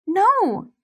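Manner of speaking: high, frightened cry; whimpering no. Acted. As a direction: cry out in fear. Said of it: "I whimper".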